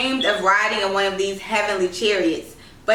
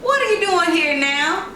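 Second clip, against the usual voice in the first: a lightly raised, hearty man's voice; voiced jovial driver; 8 semitones higher than usual. A narrator's reading. Driver hails the little girl from his car, lightly voiced and amused. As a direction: greet the girl with amused surprise.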